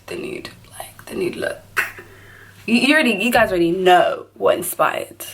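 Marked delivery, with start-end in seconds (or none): 0.1-1.6 hushed whisper, voice gone. Real, not acted. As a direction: breathe it out unvoiced. Drops to a whisper.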